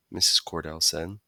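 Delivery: ordinary delivery, nothing marked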